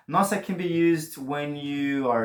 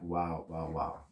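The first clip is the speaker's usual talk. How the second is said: hushed wow; 7 semitones lower, 9 dB quieter, slower than usual. Shown as a demonstration. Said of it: "nossa means, like".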